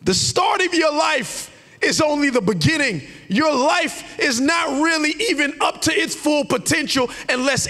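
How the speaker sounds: high, loud preached build; preaching at full force. Acted. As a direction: drive the point home to the church.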